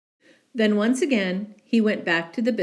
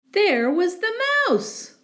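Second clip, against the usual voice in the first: much higher, louder reveal of the mouse; surprised reveal; 14 semitones higher, 4 dB louder than usual. A narrator's reading. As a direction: gasp it out, the mouse again.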